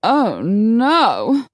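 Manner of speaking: theatrical, swooping dismay; drawn-out horrified exclamation; gasped dismay. A narrator's reading. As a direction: gasp it out in ladylike alarm. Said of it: "Lady Pamela gasped".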